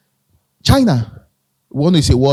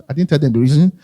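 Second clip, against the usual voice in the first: ordinary delivery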